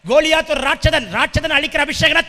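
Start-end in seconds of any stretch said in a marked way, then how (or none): none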